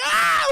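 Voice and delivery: very high loud shriek; mock shriek. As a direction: shriek in terror. Victim shrieks.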